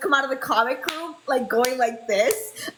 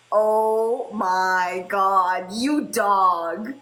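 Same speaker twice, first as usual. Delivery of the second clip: slow mock scandalized; 6 semitones lower, much slower than usual. Retold teasing reaction.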